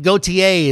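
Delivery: Gautier as "go-tee-AY"; correct pronunciation. Shown as a demonstration. Birthday host correcting his own reading of a singer's name, [name] said go-tee-AY. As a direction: end Gautier on a clear "ay" syllable. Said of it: "is how you pronounce that".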